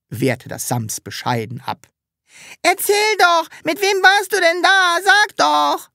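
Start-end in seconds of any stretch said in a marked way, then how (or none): 2.6-5.9 put-on high, pleading children's voices; bettelnde Kinder. A narrator's reading. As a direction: beg like eager kids. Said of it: "bettelten die Kinder". (bettelnde Kinderstimmen, hoch)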